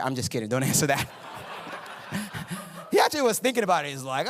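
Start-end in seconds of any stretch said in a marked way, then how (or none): 0.0-2.6 chuckling through the words; laughing. Real, not acted. Preacher teasing Ben in church, laughing. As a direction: laugh as you take the question back. Teasing a congregant about salary.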